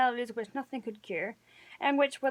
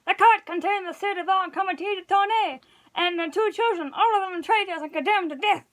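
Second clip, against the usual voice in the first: high, louder urgent announcement; frantic report; 7 semitones higher, 3 dB louder than usual. A narrator's reading. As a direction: blurt out the disaster.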